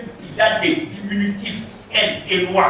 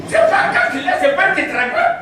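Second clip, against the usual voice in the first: laughing; 5 semitones higher than usual. Real, not acted. Mocking the four-letters name.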